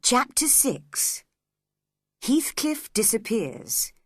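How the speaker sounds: slow, announced title; chapter heading. A narrator's reading. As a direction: read the heading out slowly.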